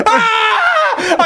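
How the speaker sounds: mock scream. [name] jumps, mock scream.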